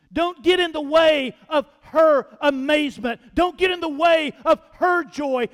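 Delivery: loud preacher's rising cry; loud sermon shout, building. Acted.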